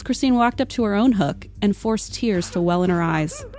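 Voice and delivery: ordinary delivery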